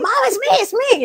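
high, loud mock alarm; retold panicked plea. Son stops Mom from punching him, panicked cry. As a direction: cry out urgently, imitating a startled son.

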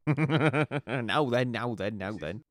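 pompous bobby, first word loud; mild plodding copper voice; dim pompous cartoon cop. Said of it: "hang on, Jimmy".

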